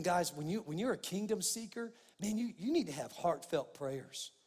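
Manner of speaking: normal talking voice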